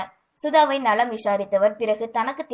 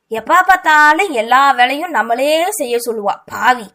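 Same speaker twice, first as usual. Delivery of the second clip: louder grumbling at his sister; grumbling scold; 3 semitones higher, 9 dB louder than usual. A narrator's reading. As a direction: scold the lazy sister under protest. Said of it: "தன் தங்கையை திட்டியவாறே".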